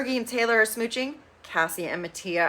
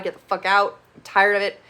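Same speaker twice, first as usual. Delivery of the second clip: normal talking voice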